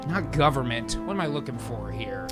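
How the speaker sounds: usual speaking voice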